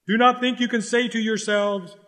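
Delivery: ordinary talk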